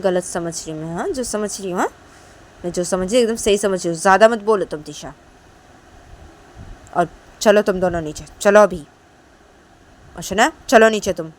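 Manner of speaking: loud sharp retort, then ordering; angry insisting. Acted. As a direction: shut her down and order them out, loud. Mother insists, orders them down.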